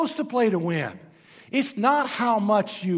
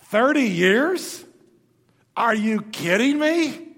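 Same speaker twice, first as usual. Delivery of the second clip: slow, louder mock disbelief; incredulous Jesus; 4 semitones higher, 6 dB louder, slower than usual. Jesus scoffing at a thirty-year plan, slow mock disbelief. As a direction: drag it out like it's absurd. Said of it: "Jesus is going".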